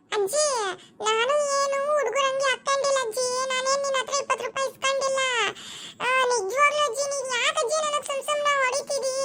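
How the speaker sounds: put-on young boy voice. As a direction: play a little boy pleading with granny. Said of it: "ಧ್ವನಿ ಮಾಡ್ಕೊಂಡು".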